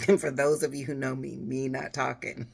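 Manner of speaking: real amusement breaking into laughter; tickled. Real, not acted. Vlogger joking about herself not talking, tickled into laughter. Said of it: "I got a little tickled".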